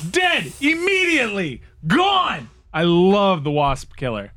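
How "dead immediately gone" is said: high, punchy triumphant words. Retold or imitated. About the dead wasps, high triumphant clipped words. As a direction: crow each word in victory. Wasp killed by spray.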